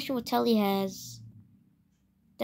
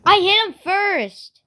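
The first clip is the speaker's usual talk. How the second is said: raging gamer; 6 semitones higher, 8 dB louder than usual. Real, not acted.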